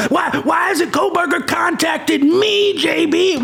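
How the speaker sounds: high, slow, whining mock voice of a needy viewer; mocking a viewer who wants attention, high and whiny; mock whiny fan. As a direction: whine it out like a spoiled fan. Mocking a whiny true crime fan.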